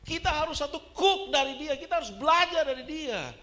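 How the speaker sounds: high, loud sermon delivery; fiery preaching. Acted. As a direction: drive it home to the whole congregation. Preaches at full force.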